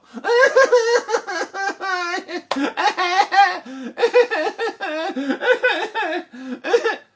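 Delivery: high, loud laughing fit in bursts; crying turns to helpless shrieking laughter; hysterical laughter. Acted. Princess seeing Jack carry the donkey, hysterical laughter. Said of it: "rolling around on the floor laughing".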